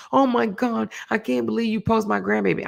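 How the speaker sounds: mock screaming. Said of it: "hollering, screaming".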